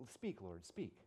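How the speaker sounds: soft, low humble reply; quiet answer. Retold or imitated. Samuel answers the Lord, soft and low.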